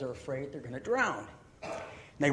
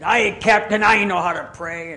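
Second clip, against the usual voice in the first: loud, higher sailor's reply; calling back; 5 semitones higher, 11 dB louder than usual. Acted. Sailor stepping up to his captain, loud call. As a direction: speak up boldly over the storm.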